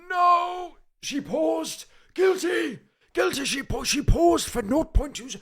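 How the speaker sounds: high-pitched, loud outburst; excited accusing shout. Real, not acted. Streamer catching a contestant pausing over her answer, shouted accusation. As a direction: yell the accusation, voice shooting way up.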